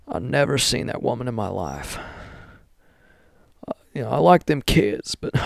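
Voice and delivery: hammed-up impersonation of a former president; Bill Clinton. Mocking a former president's denials, put-on impersonation. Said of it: "Bill talks like this, you know".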